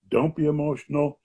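usual speaking voice

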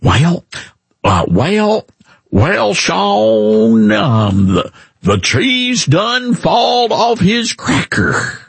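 slow, stretched mock Southern twang; exaggerated down-home Southern accent; folksy Southern drawl. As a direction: imitate a folksy Southern senator, drawing out the vowels. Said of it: "He says all those folksy, folksy things like".

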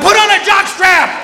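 high, drawn-out comic yell; exasperated yell. Retold or imitated. Tourist yells back at naked guide, high indignant shout.

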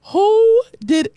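WHO pitched very high and loud; emphatic WHO. Shown as a demonstration. Radio preacher showing listeners how to say it, emphatic high WHO. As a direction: belt out a long, high "Who" before "did". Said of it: "you have to say it like that".

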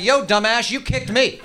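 lightly voiced macho coffee table talking back. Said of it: "the coffee table's ever like".

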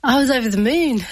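over the moon, laughing through it. Real, not acted. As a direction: let the joy spill into a laugh. Joyful telling, laugh heard.

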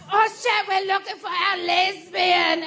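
loud, high, slowed panic; hammed-up alarm, searching for someone; mock panicked shout. Salon staff calling out, searching for her, mock panicked shout. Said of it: "the second one's like".

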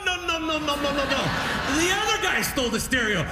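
put-on high, excited old dad protesting at the TV; mock dad at the TV. Dad arguing with Judge Judy on TV, high mock protest. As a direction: squeal no over and over like dad.

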